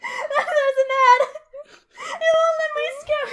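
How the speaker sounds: high laughing complaint. Real, not acted. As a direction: laugh while frustrated. Laughing while fumbling ad.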